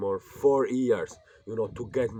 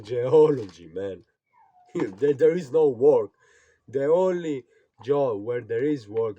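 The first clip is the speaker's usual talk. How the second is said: louder, higher, exasperated rant with a laugh; heated rant; 4 semitones higher, 10 dB louder than usual. Real, not acted. Student scoffing at geology, loud and exasperated. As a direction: push the disbelief out loud. Ranting about career choices.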